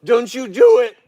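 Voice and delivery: high, loud warning shout; firm warning shout. Real, not acted. Owner warning a bear off the cushion with a loud shout. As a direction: bark the warning at her like a strict owner. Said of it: "When I talk to her like this".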